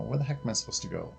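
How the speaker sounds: ordinary talk, nothing marked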